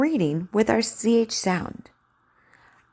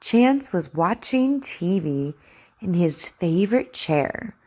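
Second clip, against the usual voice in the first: slow, clear story reading; over enunciating. Acted. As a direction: read to a child, carefully. Reads the story aloud for practice.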